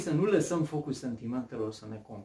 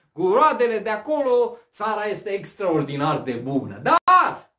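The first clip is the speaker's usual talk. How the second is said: preaching at full force; 7 semitones higher, 7 dB louder than usual. Acted. Preacher retelling the spies' story.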